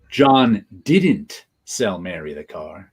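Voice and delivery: long emphatic "didn't", the rest low; stress on DIDN'T. Shown as a demonstration. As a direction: hit "didn't" hard, slow the sentence. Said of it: "you put the emphasis on each".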